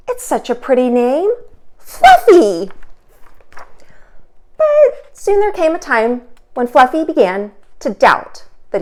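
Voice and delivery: lively, expressive storybook reading; animated read-aloud for kids; reading to children. A narrator's reading. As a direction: read it aloud for little listeners. Teacher reads picture book aloud.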